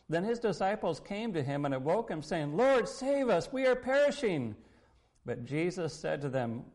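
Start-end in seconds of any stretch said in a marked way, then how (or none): 2.5-4.5 loud, high cry for help; retold desperate call to be saved; alarmed plea. Disciples waking Jesus in the storm, alarmed plea.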